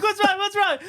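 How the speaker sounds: high, urgent alarmed questioning; frantic asking. Retold or imitated. Scared kid asks his hallucinating brother, high and urgent. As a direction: ask what's wrong in a panic. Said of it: "I'm like".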